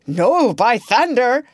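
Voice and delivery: high, loud refusal; defiant cry. A narrator's reading. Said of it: "Starkey cried".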